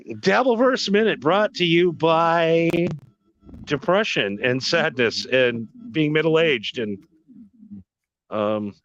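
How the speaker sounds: ad read. Acted. Mock sponsor read.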